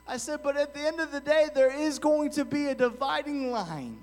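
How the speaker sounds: high, loud pulpit preaching; a preacher at full force, high and loud. Acted. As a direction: drive it home to the congregation.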